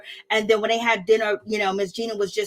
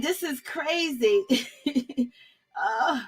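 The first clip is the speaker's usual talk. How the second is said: ordinary talk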